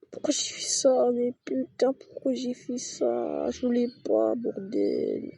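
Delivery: high, exasperated cry at his own mistake; frustrated outburst. Real, not acted. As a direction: yell at yourself for a dumb misclick. Crafting mistake, swearing at himself.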